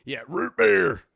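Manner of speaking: loud, high, hammed-up country twang; over-the-top country accent. Shown as a demonstration. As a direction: overdo the country accent, blasting it out. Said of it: "That's too over the top".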